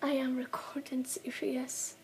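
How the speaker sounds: insistent hushed whisper. Real, not acted. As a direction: whisper it firmly to your sister. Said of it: "Sorry for the whispering".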